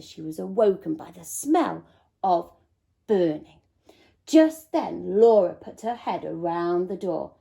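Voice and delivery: ordinary delivery, nothing marked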